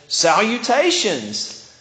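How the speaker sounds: fancy greeting. Acted. Charlotte the spider greeting in a fancy way, grand. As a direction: greet them with a flourish. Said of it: "That was like a fancy greetings".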